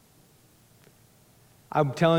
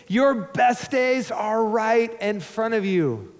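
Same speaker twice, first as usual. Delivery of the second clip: rising, louder preacher's promise; preaching at full force; 6 semitones higher, 3 dB louder than usual. Acted. Pastor rallying the congregation, preaching high and loud. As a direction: lift the room, climbing higher.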